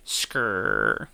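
low imitated window motor whir; car window noise. Shown as a demonstration. Bored listener miming the window rolling down, low whir. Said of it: "That's me rolling the window down".

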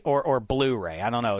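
ordinary talk

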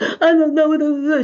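high, drawn-out imitation wail; mock crying. Retelling her friend's crying on the way, mock weepy. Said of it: "She's crying".

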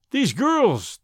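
exasperated cry. A narrator's reading. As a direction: throw up your hands at them. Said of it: "he cried".

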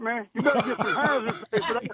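usual speaking voice

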